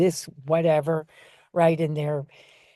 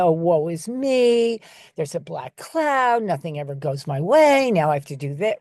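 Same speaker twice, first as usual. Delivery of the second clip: mock whining. Trainer showing someone's negative child voice, mock whining. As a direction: play the woe-is-me negative child. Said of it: "they're whining".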